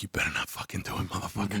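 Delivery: whispered warning under the breath; low, secretive threat to a colleague; hushed warning. Retold or imitated. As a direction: mutter it so only he hears. Agent's silent warning look voiced.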